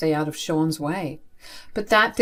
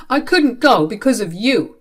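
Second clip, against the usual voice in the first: loud, higher accusation; blaming; 4 semitones higher, 6 dB louder than usual. Acted. Brother blaming sibling for missed interview, accusing. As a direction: pin the blame on them.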